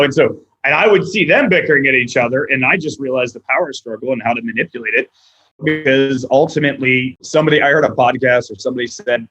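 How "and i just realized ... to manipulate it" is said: ordinary talk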